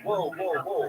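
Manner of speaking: retold alarm. Backing off from the man with the gun, startled. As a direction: act taken aback, trying to calm him. Retold reaction to gunman's order.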